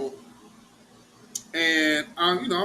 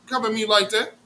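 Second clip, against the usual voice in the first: normal talking voice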